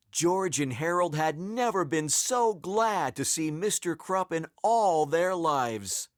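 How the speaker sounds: usual speaking voice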